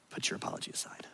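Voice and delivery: breathy whisper, barely voiced. Real, not acted. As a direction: whisper it like a secret. Intimate appeal to listener.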